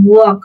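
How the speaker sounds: ওয়াক with no l before the k; silent l. Shown as a demonstration. Teacher demonstrates walk for learners, ওয়াক with the l silent. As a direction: say ওয়াক with a single o-like vowel. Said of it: "এখানে এল কিন্তু silent থাকবে".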